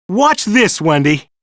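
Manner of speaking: showing off. A narrator's reading. Peter showing off to Wendy, high cocky call. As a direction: brag playfully.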